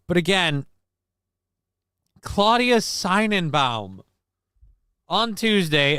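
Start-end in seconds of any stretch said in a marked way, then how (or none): 2.2-4.0 drawn-out name. Pretend.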